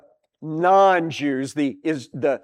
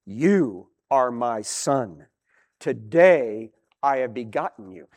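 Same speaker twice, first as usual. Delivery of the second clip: voice of God; 6 semitones lower, 4 dB quieter than usual. Acted. God declaring to his son, low and solemn. God speaking within the psalm.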